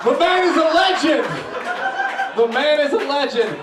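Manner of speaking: loud, high proclamation; laughing shout. Real, not acted. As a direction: shout the tagline over laughter. Comic shouting his closer.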